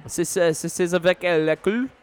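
put-on French accent, rising at the end; attempted French. Shown as a demonstration. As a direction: repeat the halting French question on request. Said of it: "Do it again".

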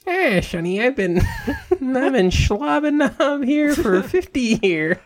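put-on high, slow, laughing old woman; old lady voice. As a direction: play a cackling old-timer. Old worker character.

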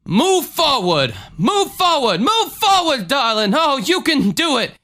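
high, mounting preacher exhortation; Jim Jones. Acted. Healer urges old lady to walk, high fervent preacher voice. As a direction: urge her on with climbing pitch. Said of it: "And he says".

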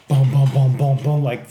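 loud, rapid booms; punchy vocal booms for stomping feet; imitated stomping. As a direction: mimic feet pounding overhead. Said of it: "just feet stomping".

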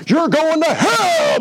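put-on high, slow, hammering old lady voice; blunt grandmother. Grandson telling how grandma preached, high slow stern grandma voice. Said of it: "That's the way she communicated".

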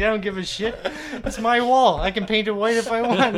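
loud, high mock indignation; defiant. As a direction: act out a stubborn owner protesting. Retelling the defiant shop owner.

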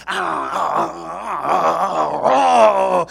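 long, loud effort yells; big dog's straining roar. Acted. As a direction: roar while wrestling a stuck door. Big dog struggling with the door. (gritos largos de esfuerzo)

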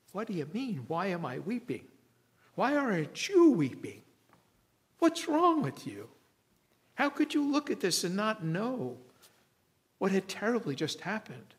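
incredulous outburst. Acted. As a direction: build from confusion to an exasperated cry. Mary answers the angels indignantly.

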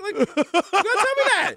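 high-pitched comic disbelief; mock outrage. Partner learning what the translator left out, mock outrage. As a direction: protest in exaggerated, high-pitched alarm.

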